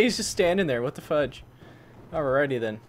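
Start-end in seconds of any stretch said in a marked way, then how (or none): none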